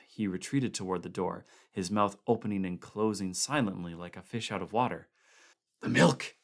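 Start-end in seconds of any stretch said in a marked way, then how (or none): 5.8-6.4 horrified gasp. A narrator's reading. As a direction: choke it out in horror. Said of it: "he gasped".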